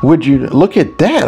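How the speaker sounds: playful wide-eyed wonder. A narrator's reading. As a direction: marvel at it with a swooping lilt. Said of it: "I said in wonder".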